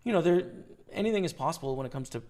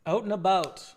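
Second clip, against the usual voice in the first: "Out and about" with the Canadian "about", louder and higher; Canadian accent; 5 semitones higher, 7 dB louder than usual. Shown as a demonstration. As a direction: play up the Canadian vowel in "about". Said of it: "check your Canadian accent".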